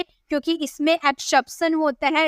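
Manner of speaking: ordinary talk, nothing marked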